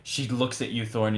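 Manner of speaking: ordinary talk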